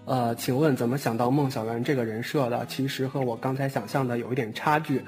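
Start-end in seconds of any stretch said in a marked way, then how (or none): none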